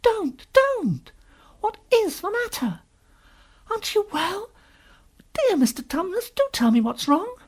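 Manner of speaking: put-on high, pleading little girl; great distress. Lucy pleading with the sobbing faun, in great distress. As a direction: beg anxiously in a child's high voice. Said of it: "said Lucy in great distress".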